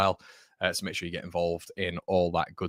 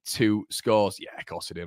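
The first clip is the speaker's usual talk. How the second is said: ordinary talk, nothing marked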